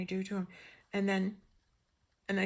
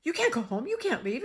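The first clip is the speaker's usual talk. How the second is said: high, louder retold objection; acted protest; 5 semitones higher, 5 dB louder than usual. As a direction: urgently forbid them to leave. Acted staff refusing to let her go.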